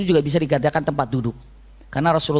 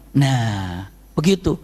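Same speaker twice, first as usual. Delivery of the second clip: usual speaking voice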